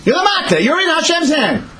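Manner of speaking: high-pitched exclamation of disbelief; exasperated outburst. Acted. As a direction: pitch leaps way up in protest. Exasperated protest at Moses's refusal.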